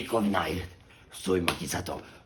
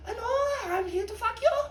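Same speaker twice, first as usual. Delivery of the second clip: put-on squeaky, much higher, louder girly voice; coy prostitute; 5 dB louder than usual. Body double greeting the pig, in a squeaky high girly voice. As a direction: play the body double as a breathy ingenue.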